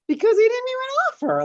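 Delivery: amused outrage about rude men; indignant laughing. Real, not acted. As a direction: protest with a laugh still in it. Indignant aside amid laughter.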